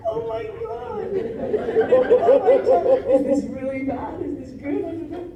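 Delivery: high, loud, laughing panic; panicked. Retold or imitated. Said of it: "I'm like".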